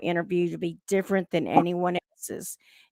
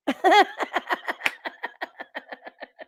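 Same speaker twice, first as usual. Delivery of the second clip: airy laugh; 7 semitones higher than usual. Real, not acted. Laughs after twang demo.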